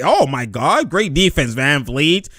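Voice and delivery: ordinary delivery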